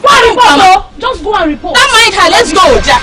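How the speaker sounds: loud scornful dismissal; dismissive shout. Acted. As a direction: wave her off loudly. Dismissive shouting as rival leaves.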